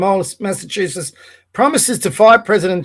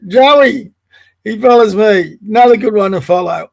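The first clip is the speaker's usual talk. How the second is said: ordinary talk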